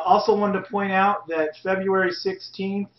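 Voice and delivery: ordinary talk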